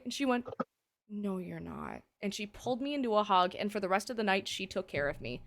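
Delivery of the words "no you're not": low, hushed motherly reply; gentle contradiction. Retold or imitated. Ex's mom seeing through her denial, soft and low. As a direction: drop low and soft, imitating a knowing mom.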